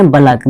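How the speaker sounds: "बलक" as two syllables, ba-LAK; "बलक" ending in -lak, not -laik; taught pronunciation "बलक". Shown as a demonstration. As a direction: say "बलक" with a vowel after the b. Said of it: "क्या बोलेंगे".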